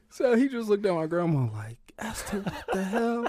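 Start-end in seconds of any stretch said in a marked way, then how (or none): 2.0-3.3 high, laughing exasperation; a high laughing protest; laughing disbelief. Retold or imitated. Granddad looks at grandma, high laughing exasperation.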